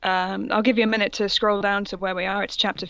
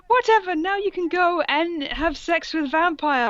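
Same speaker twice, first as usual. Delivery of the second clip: mock shout; 9 semitones higher than usual. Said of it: "I shouted".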